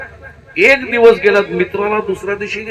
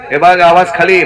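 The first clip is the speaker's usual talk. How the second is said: louder firm order to his wife; firm warning; 6 dB louder than usual. Acted. Husband musters courage to warn his wife, loudly firm. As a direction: finally stand up and put your foot down. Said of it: "मित्राला मनातल्या मनात आठवत होता".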